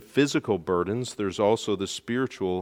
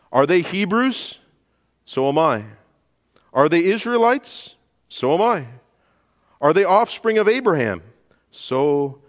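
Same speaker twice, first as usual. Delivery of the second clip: rising rhetorical challenge; 7 semitones higher, 4 dB louder than usual. Acted. Paul's rhetorical boast read.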